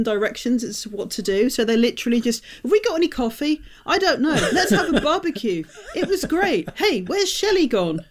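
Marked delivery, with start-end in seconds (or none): 2.6-8.0 mock inane characters. Mocking vapid film characters.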